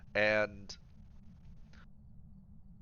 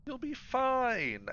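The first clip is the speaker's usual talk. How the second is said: mock reassuring; much slower than usual. Teasing the sacrificial lamb.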